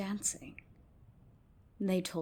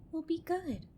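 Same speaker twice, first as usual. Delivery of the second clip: put-on much higher, childlike promise; promising girls; 11 semitones higher than usual. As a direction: promise like small girls, high.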